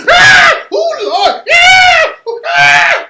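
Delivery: loud, high-pitched hammed-up shrieks; mock screaming. Host imitating onlookers screaming when something goes down, mock screams. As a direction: scream wildly like a panicking bystander.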